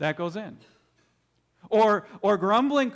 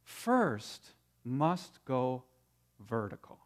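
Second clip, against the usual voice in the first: very slow, dropping low on vertical; slow emphasis; 7 semitones lower, 5 dB quieter, much slower than usual. Shown as a demonstration. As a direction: draw out each word and sink. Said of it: "we want to see that complaining".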